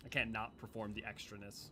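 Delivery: ordinary talk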